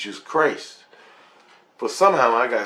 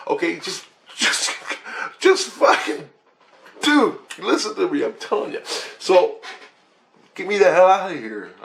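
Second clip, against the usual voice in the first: desperate plea; 8 semitones higher, 4 dB louder than usual. Retold or imitated. Exasperated plea to Christ.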